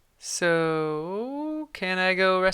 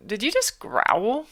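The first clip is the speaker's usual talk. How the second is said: surprised question; 5 semitones higher, slower than usual. Acted.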